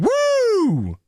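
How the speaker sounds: a giddy cry of liberation, hammed up; mock whoop. [name] throwing booze bottles out the window, mock whoop.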